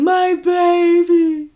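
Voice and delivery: loud, high, drawn-out wail; hammed-up anguished cry; mock crying wail. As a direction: imitate a grieving father crying out. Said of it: "he's just crying".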